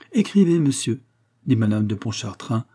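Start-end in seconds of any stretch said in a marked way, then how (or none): 0.1-1.0 higher, stagy order; solemn command. A narrator's reading. Madame de Pontchartrain orders the notary, stagily solemn. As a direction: command like an old stage actress. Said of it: "d'un geste dont la solennité".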